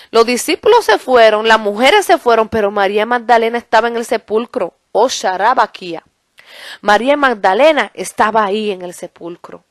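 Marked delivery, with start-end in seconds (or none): 4.9-6.0 unknown syllables, prayerful and low; speaking in tongues. Real, not acted. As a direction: break into tongues as a quick aside. Speaking in tongues mid-sermon. (hablando en lenguas en voz baja)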